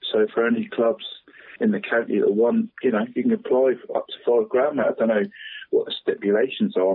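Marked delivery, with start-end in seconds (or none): none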